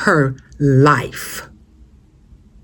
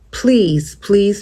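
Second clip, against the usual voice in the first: usual speaking voice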